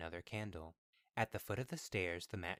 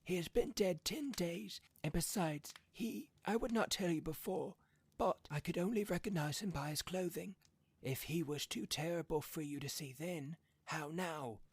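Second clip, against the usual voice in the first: higher, trembling voice; shaking voice; 10 semitones higher than usual. A narrator's reading. Husband warns wife about their dead son, voice shaking. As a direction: let the voice shake. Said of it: "and his voice shook".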